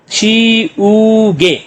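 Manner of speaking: "छी ऊ गे" very slow, three long held syllables. Shown as a demonstration. Teacher blending three syllables, "छी ऊ गे" slowly separated. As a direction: sound out "छी", "ऊ", "गे" with gaps. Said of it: "तो क्या बन गया".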